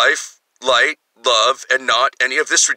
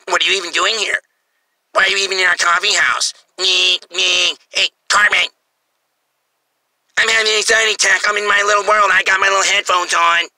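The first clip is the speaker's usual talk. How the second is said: lightly voiced snooty coffee-house snob; a touch of a sneering rich brat; mock rich snob; 4 semitones higher than usual. Comedian mocking a rich snob at the coffee house, lightly voiced. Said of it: "someone who says".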